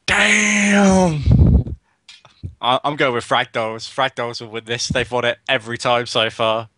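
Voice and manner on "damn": high, loud, stretched "damn"; drawn-out exclamation. Real, not acted. Co-caster reacts to the raffle finalists, long loud exclamation. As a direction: let the word stretch out loud.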